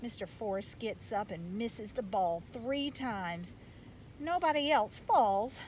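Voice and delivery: ordinary delivery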